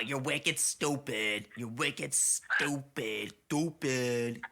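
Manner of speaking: slow, drawn-out mock Bostonian; stretched Boston drawl; Boston accent. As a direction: tease in a broad Boston accent. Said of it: "guy from Boston".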